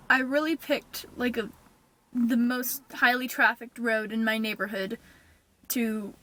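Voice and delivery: ordinary talk, nothing marked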